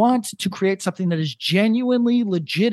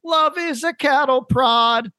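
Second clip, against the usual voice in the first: high, slow, drawn-out mock singer; obnoxiously high voice; 7 semitones higher, slower than usual. Guy singing over a hit record, obnoxiously high voice. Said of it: "guy with an obnoxiously high voice".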